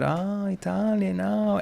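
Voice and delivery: soft, slow, high drawn-out oohing; mock intrigued reaction. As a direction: imitate someone quietly impressed. Mimics customer's reaction to Italian.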